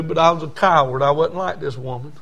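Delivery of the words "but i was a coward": ordinary talk, nothing marked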